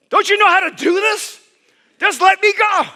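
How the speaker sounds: put-on high childish plea. Ten-year-old pleading with mom.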